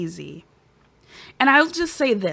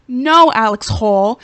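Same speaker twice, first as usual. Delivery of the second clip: loud callout; 11 dB louder, slower than usual. Real, not acted. Calling out a cast member.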